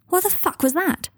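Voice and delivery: high startled outburst; shocked cry. A narrator's reading. Eve startled by the green flame, crying out high. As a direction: jump up in alarm at it. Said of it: "cried Eve".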